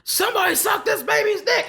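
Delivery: high-pitched mock shout with laughter in it; imitated club yell. Podcaster imitating an actor yelling in a club, high mock shout. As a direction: call it out high, as if on a club microphone. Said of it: "He had a microphone yelling in the club".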